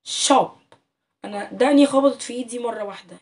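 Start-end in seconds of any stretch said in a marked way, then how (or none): 0.0-0.5 شوب said loud and clear as one beat; teacher pronouncing شوب for the class; word said for counting. Shown as a demonstration. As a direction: sound out شوب as a single syllable. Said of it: "تعال نجرب كده كلمات زي كلمة".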